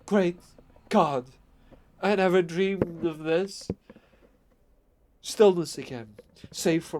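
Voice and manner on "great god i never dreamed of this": terrified friend refusing to tell; fear and despair. Acted. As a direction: voice cracking with dread. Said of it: "still hoarse with fear and now apparently tinged with despair".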